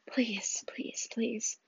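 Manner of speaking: breathless whispered begging; whispered plea. Acted. Mother driving through the storm, whispered pleading. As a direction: whisper it as a desperate prayer.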